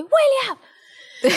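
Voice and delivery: sudden high sharp yell of his name; yelling at son. Retold or imitated. Mother yelling at toddler to get into the bath, sharp yell. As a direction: snap at the child to get in the bath. Mom finally yells at son.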